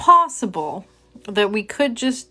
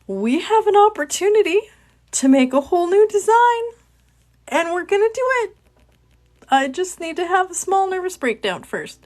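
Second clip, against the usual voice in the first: much higher, jittery voice from nerves; high nervous brave face; 10 semitones higher than usual. Real, not acted. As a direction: talk yourself through a panic, pitched up. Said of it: "Why did my voice go up five octaves".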